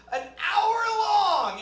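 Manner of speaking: high, loud, drawn-out complaint; incredulous wail; exasperated yell. Real, not acted. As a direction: cry out in disbelief, stretching it. Exasperated about the hour.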